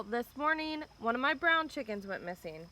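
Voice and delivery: ordinary talk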